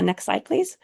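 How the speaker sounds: ordinary delivery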